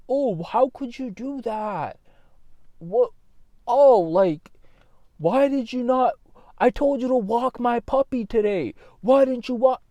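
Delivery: high, whiny mock complaint about the puppy; mocking whiny partner. Vlogger mocks an overemotional partner, high whiny complaint.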